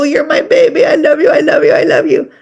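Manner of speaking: high, gushing mock baby talk with a catch in the voice. Owner cooing to the pet as a baby, high mock gushing. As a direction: coo at the pet like it's a baby. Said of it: "talk to our babies and go".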